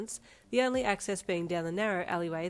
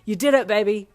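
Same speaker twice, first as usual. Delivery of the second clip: louder cheer; excited yell; 3 semitones higher, 13 dB louder than usual. Acted. Boyfriend cheering her acceptance, louder. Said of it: "he yelled with excitement".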